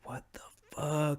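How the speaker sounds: softly voiced stunned what the fuck; baffled. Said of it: "and now you're like".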